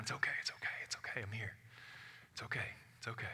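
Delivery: soft, near-whispered reassurance; Jesus soothing Mary, softly and gently; calming her down. A narrator's reading. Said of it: "trying to calm her down".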